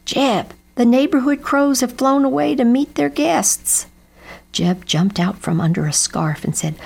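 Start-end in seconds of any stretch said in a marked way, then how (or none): 0.0-3.9 higher-pitched, mildly raised storybook shout; calling out. A narrator's reading. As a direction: call the news across the field. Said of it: "Daddo shouted".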